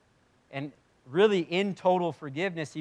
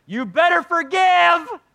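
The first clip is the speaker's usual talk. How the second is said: very high, loud, drawn-out yell; mock stern shout; 14 dB louder, slower than usual. Imagined God looming above a hurt believer, mock threatening shout. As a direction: holler it like an overbearing threat.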